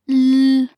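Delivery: "l" alone as a held, blurred dark sound. Shown as a demonstration. Teacher isolating the sound for learners, dark "l" held. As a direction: hum out a dark "l", not the letter name. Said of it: "加上模糊舌边音".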